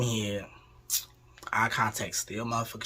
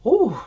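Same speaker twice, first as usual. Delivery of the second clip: high loud whew; 13 semitones higher, 10 dB louder than usual. Retold or imitated. Cooling off after pain.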